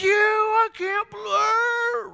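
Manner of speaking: high, loud, drawn-out yell of outrage; mock road-rage scream. Pastor reenacting screaming at a driver, hammed-up outrage. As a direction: replay the scream at the driver who cut you off, slow and furious. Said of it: "screaming at him".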